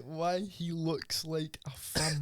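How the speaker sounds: slow, drawn-out rereading of the question; slow repeat. Retold or imitated. Rereading the question slowly to the fighter, drawn out. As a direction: spell it out slowly for him. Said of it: "He said".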